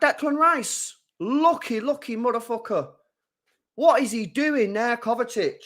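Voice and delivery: high, strained disbelief, still seething; high exasperated grumbling at a teammate's blunder; fuming rant. Real, not acted. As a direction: rant at the screen in raw irritation. Fuming over the reckless tackle.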